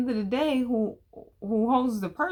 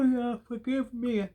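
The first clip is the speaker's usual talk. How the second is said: ordinary talk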